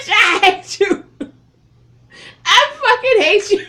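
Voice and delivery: very high, loud laughing outburst; laughing shriek. Real, not acted. Laughing at Chaka on screen, shrieking very high and loud. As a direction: scream it through laughter.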